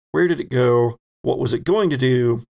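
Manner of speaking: ordinary talk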